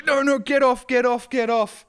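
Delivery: high, loud shouting get off; yelling at a seagull to get off; shooing shout. Retold or imitated. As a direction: chase the bird off, yelling. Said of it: "walk back over and go".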